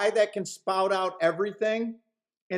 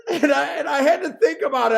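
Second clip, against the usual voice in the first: laughing while talking; cracking up mid-sentence; 7 semitones higher, 6 dB louder than usual. Real, not acted. Laughing hard at own joke.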